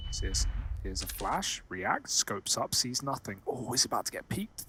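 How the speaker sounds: low, soft tense commentary; hushed play-by-play. Real, not acted.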